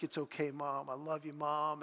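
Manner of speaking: soft, tender reassurance to his crying mother; son comforting mom. Retold or imitated. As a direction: hush it gently, like a son holding his mom. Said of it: "and he's like".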